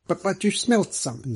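a touch of wounded reproach; reproachful. Acted. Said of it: "said Mister Middleton reproachfully".

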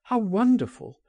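high-pitched cry of wonder; delighted, swooping exclamation; exclaimed wonder. A narrator's reading. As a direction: exclaim it high, falling away. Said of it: "he cried".

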